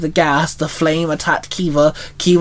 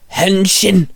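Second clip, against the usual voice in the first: high, ringing hero call; transformation call; 4 semitones higher than usual. A narrator's reading. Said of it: "Shinzo called out".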